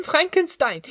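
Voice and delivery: "Frankenstein!" shrieked very high with a falling end; boy proudly crying "Frankenstein!" in a high child's voice; terrified child. A narrator's reading. Boy naming his father to scare the monster, shrieked very high. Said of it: "he cried".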